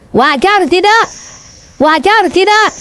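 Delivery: high-pitched challenge to the crowd; rallying call. Real, not acted. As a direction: throw the question out high, demanding an answer. (seruan tinggi menuntut jawaban jemaah)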